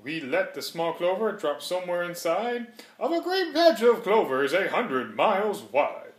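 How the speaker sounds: plain reading voice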